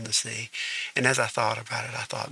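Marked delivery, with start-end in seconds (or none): none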